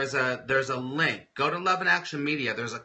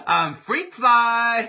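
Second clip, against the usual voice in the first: higher, louder and slow, relishing the words; playful excited; 8 semitones higher, 7 dB louder, much slower than usual. Real, not acted. Host reads a viewer's silly word, playful and excited. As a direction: savor the silly phrase.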